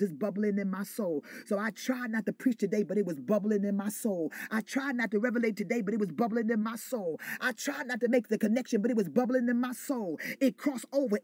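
loud, rhythmic preaching cadence; fervent preaching. Real, not acted. Preacher building fervour before the congregation, full preaching. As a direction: preach it with rising fire.

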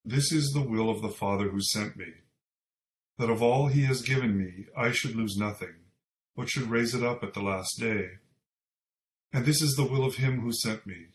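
ordinary talk, nothing marked

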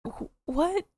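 high, soft baffled "what?"; confused what. Retold or imitated. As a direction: sound utterly lost. Imagined confused viewer reaction.

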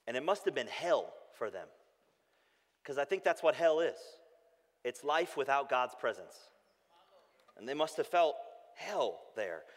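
ordinary talk

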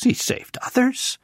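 a hint of sneering mockery; mocking priests. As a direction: lightly sneer it like scornful priests. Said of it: "mocked him among themselves".

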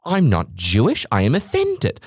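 slow, a hint of an offended prude; lightly voiced huffy outrage, slow; imagined customer taking offence, lightly hammed; mock offended snob. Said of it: "you wouldn't hear me being like".

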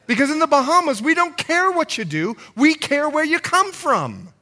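loud, high preaching voice; impassioned preaching. Real, not acted. Preacher urges church to keep sight on Jesus, impassioned preaching. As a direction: drive it home to the congregation, loud and high. Preacher builds to full force.

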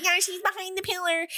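high, chirpy statue voice; talking statue. Retold or imitated. A living statue gives Alex away, high chirpy voice. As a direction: squeak it out like a tattletale statue. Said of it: "the statue comes to life and she's like".

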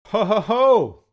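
booming Santa laugh, high and loud; jolly ho ho ho. Acted. Jolly laugh after show intro.

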